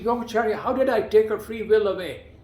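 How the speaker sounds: loud, high, upset retort to the guru; heated demand to the teacher; angry protest. Retold or imitated. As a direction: protest hotly, feeling wronged. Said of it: "And I got angry".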